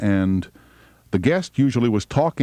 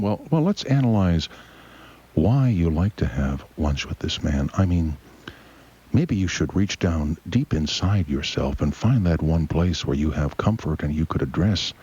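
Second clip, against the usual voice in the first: mock radio shrink; 3 semitones lower than usual. Voicing radio psychologist and caller.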